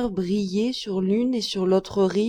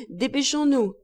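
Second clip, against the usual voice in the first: mild call; 5 semitones higher than usual. A narrator's reading. Captain hurrying passengers off, mildly calling out. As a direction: call it on a raised pitch. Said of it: "Le capitaine criait".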